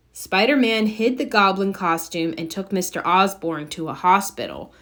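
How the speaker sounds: usual speaking voice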